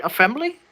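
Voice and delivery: "Assembly" as "afembly", high and questioning; puzzled "afembly" for "Assembly"; "Assembly" said "afembly" with a rise; long-s misreading. Shown as a demonstration. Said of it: "You didn't say assembly".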